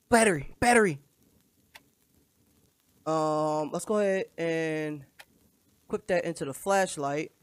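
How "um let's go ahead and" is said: ordinary talk, nothing marked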